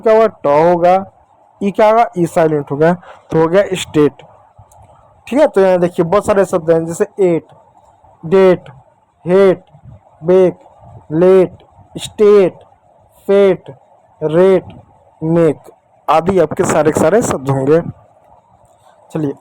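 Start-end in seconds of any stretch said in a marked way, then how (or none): 3.7-4.2 स्टेट with a long "ay" vowel and silent final e; long-a pronunciation. Shown as a demonstration. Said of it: "ए की मात्रा के रूप में". 7.2-15.7 एट, डेट, हेट, बेक, लेट, स्टेट, फेट, रेट, मेक one by one, each with long "ay"; long-a word drill. Shown as a demonstration. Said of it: "ए का उच्चारण ए की मात्रा".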